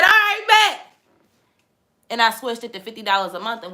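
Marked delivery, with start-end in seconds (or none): 0.0-0.9 all right, bet. Retold or imitated. Retelling her eager reply.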